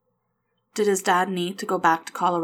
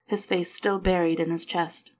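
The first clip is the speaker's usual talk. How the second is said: plain reading voice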